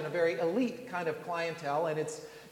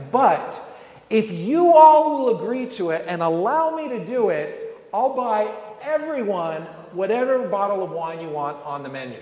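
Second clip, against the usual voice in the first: grand announcement; 2 semitones higher, 10 dB louder than usual. Retold or imitated. Jack Nicholson bargaining with the diners, loud mock-grand speech. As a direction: address the whole restaurant like a showman. Jack addresses the whole restaurant.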